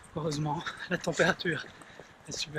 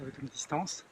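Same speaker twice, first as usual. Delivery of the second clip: normal talking voice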